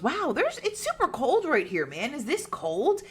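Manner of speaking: high-pitched imitation of a startled ghost hunter; wide-eyed wonder, played up; mock amazement. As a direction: exclaim in a hammed-up, high voice. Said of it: "as Zak is freaking out, goes".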